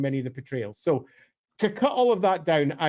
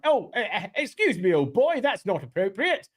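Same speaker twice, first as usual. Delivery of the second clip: put-on higher-pitched upper-class old boy; flustered gent in a stock upper-class English accent; upper-class British accent; 4 semitones higher than usual. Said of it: "upper class".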